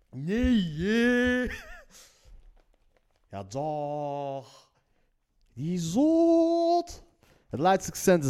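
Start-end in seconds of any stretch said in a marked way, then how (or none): 0.1-1.5 high, drawn-out Leiden exclamation; singsong Leiden accent; broad Leiden accent. Acted. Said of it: "het echt Leids accent is van". 3.3-4.7 stretched, softer Leiden brush-off; broad Leiden accent. Acted. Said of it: "het echt Leids accent is van". 5.5-7.1 very high, loud Leiden exclamation; singsong Leiden accent, pitched way up; broad Leiden accent. Acted. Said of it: "het echt Leids accent is van".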